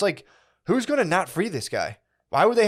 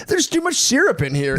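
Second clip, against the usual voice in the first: whiny complaint; 12 semitones higher, 3 dB louder than usual. Pretend. Mock complaint over coffee.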